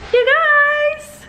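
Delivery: high, loud excited shout to viewers; excited vlog greeting. Acted. Vlogger greeting her viewers, high excited shout. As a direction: burst in hyped for the camera.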